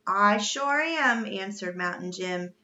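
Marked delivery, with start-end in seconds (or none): 0.1-1.3 slow, louder, higher affirmation; slow emphatic confirmation; emphatic reply. A narrator's reading. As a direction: draw it out with relish. Sailor's drawn-out reply to shock.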